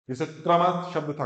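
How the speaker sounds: ordinary talk, nothing marked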